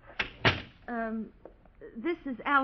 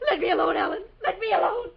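high tearful cry, climbing; sobbing protest; 7 semitones higher, 3 dB louder than usual. Acted. Grieving wife to family friend, sobbing protest. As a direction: push someone away through sobs.

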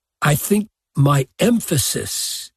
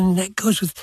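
usual speaking voice

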